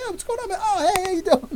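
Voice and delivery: over-the-top high hello; exuberant greeting. Retold or imitated. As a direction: act out pouncing on someone for a hug. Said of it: "but like".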